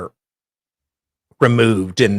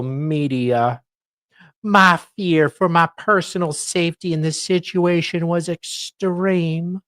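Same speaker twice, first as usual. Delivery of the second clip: mock-dramatic reading; 9 semitones higher, 7 dB louder, slower than usual. Reads court document aloud.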